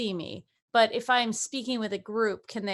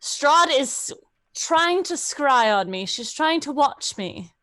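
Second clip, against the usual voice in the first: raised, urgent character voice; Silmi; 4 semitones higher, 6 dB louder than usual. Acted. Sister warning her brother she is being scried on, loud and urgent. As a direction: announce it loudly, as an alarm. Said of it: "So I get into my Silmi voice".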